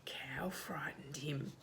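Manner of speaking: ordinary delivery, nothing marked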